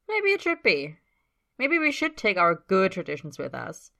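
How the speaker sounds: provocative tease. A narrator's reading. Tyler challenging teammate, provocative.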